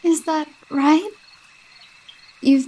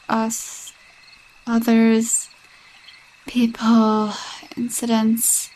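whispered hint; 2 semitones lower, slower than usual. Acted. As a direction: whisper the word incidents. Slips in a dark hint.

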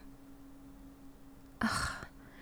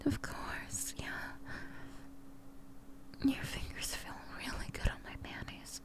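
whispering; 9 dB quieter than usual. Acted. Aroused hush in public.

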